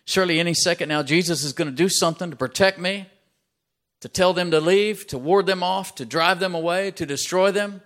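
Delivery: a dying man willing Jesus to act; anxious hope. Acted. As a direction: press it out as rising desperation. Man's desperate hope for Jesus.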